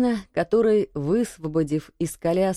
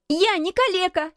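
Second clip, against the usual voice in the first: put-on very high, raging boy; high boyish fury; furious cry; 11 semitones higher than usual. A narrator's reading. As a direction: protest furiously in a high child's voice. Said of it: "с яростью закричал мальчик".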